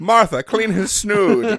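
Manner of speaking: high, slow, hammed-up command; old-timey aristocrat parody; mock period-drama toff. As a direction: order the maid around pompously.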